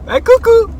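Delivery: very high, loud sing-song hello; high-pitched greeting. Acted. Vlogger greeting his dog in a very high voice. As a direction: squeal hello to the pet.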